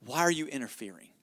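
low, softly read question; subdued quote. Retold or imitated. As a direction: quote it quietly and low, falling away. Quotes the enemy's word, first reading.

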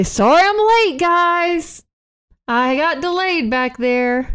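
high, drawn-out late-arrival call; hammed-up movie hero calling in; Randy Quaid. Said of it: "we hear Randy Quaid say".

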